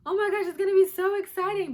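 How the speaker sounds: high, loud, gushing squeal; squealing excitement. Retold or imitated. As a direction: squeal like a thrilled friend. Imagined happy friends gush.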